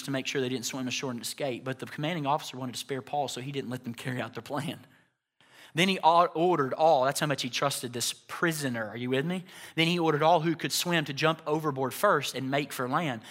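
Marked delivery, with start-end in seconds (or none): none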